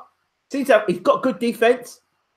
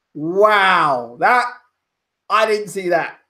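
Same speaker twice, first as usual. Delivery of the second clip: normal talking voice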